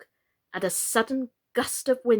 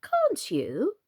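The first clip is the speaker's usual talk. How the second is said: pitying; 6 semitones higher than usual. Acted. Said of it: "in a pitying tone".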